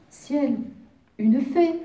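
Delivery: high, loud gasp of wonder; amazed thought. A narrator's reading. Wolf discovers what he takes for a fairy, amazed. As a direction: marvel out loud at a magical sight. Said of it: "pensa le grand loup".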